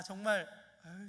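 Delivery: normal talking voice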